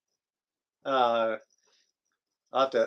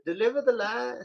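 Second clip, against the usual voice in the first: quoting the line; 8 semitones higher, 6 dB quieter than usual. Retold or imitated. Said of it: "I think that's what it was".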